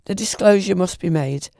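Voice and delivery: plain reading voice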